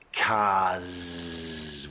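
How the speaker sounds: cars with a long buzzing z at the end, said slowly; long voiced final s. Shown as a demonstration. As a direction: stretch the end of cars into a gentle z. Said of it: "would be a voiced one".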